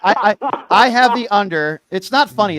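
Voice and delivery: loud, high-pitched comic protest; mock indignation. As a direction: raise your voice, insisting through the laughter. Mock protest over a teeth bet.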